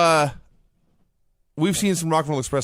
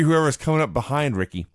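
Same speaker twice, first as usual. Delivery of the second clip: usual speaking voice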